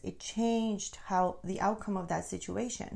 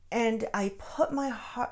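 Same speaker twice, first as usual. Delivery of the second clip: ordinary talk, nothing marked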